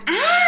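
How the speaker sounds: high-pitched acted "aah" scream; retold scream. Frightened boy screaming at the ghost, high acted yell. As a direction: cry out in mock terror. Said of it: "cậu mới la làng lên".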